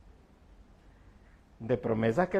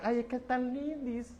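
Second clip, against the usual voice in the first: softer, higher gushing "Ay, es que es tan lindis"; mock youth slang; 5 semitones higher, 9 dB quieter than usual. Preacher mocks church youth in the hallways, gushing softly. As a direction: parody the gushing teens in the hallway. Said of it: "Cuando dicen".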